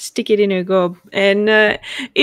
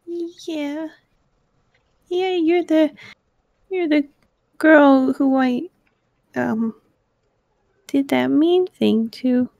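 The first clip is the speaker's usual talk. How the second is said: tiny halting voice, high and soft; small, frightened child answering a bully; scared little girl; 6 semitones higher, 3 dB quieter than usual. Acted. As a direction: stammer timidly, trailing off between phrases. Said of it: "is scared spitless".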